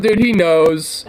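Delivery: ordinary delivery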